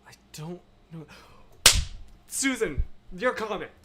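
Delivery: usual speaking voice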